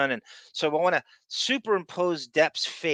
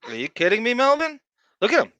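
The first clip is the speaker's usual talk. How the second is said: excited teasing; 9 semitones higher, 5 dB louder than usual. Real, not acted. Host teasing Melvin over a silly video, excited. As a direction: voice jumps up, louder, playful disbelief.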